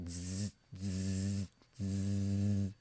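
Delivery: low, soft, sustained bee buzz. Shown as a demonstration. Teacher imitates a bee for the consonant, buzzing. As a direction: hold a long buzzing zzz. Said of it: "it sounds like a B".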